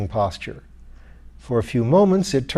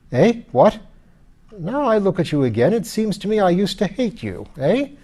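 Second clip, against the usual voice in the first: put-on louder, peevish old lord; grumbling skeleton lord; 8 semitones higher, 3 dB louder than usual. A narrator's reading. Lord complains to his lady, loud and peevish. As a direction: complain like a sore, cranky nobleman.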